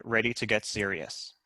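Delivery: ordinary talk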